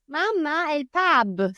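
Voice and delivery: put-on high child voice, pub said the Italian way; child telling mamma about the pub, Italian pronunciation; Italian-accented pub. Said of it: "change the accent".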